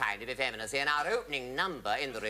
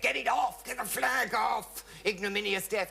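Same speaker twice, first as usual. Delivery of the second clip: high, hammed-up indignant shout; outraged cry; 12 semitones higher than usual. Vicar ordering the flag off the coffin, outraged. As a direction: call out in mock outrage, stretching the words.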